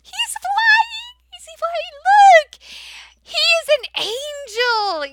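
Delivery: big amazed reaction. Shown as a demonstration. Teacher making a big deal of the flying picture, amazed. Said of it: "You make a big deal".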